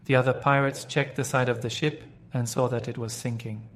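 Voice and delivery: usual speaking voice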